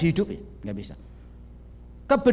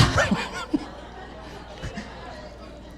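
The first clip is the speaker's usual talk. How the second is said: laugh; 10 semitones higher, 6 dB quieter than usual. Real, not acted. Laughs after joke.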